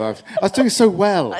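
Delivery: mock despair through real laughter; self-mocking groan with a laugh; laughing lament. Real, not acted. Laughs off his own gaffe.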